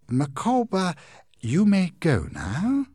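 high, loud, slow oily command; Heep's order. Acted. Heep ordering Micawber out, high and slow. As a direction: dismiss him with a sneer, drawn out.

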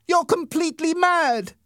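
high, loud shout. Acted. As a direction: yell it at the bully in disbelief. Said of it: "Peter shouted".

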